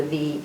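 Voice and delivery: "V" as a long buzzing "vvv"; V sound. Shown as a demonstration. Reader showing children the real sound, "V" as long buzz. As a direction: hold "V" as a buzz. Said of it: "the real sound for the V is".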